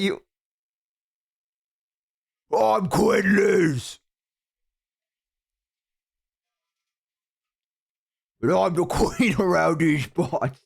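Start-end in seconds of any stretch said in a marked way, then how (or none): 2.6-10.6 shouted slow orc-like royal voice; Queen Liz as orc. Retold or imitated. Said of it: "another orc called Quinn Liz".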